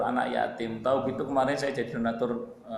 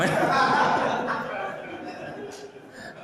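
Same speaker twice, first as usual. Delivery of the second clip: high cackling laugh. Real, not acted. Preacher cracks up at his own joke, cackling.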